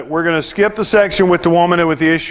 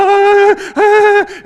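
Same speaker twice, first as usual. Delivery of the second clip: high, loud panicked yelps; panicked cries; 13 semitones higher, 4 dB louder than usual. Retold or imitated. Father panicking over bleeding toddler, high frantic yelps. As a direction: cry out in panic at the blood. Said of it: "I pick her up and I'm like".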